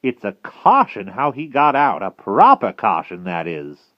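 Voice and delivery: loud witch, swooping pitch; Madam Mim. A narrator's reading. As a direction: muse aloud as a put-out witch.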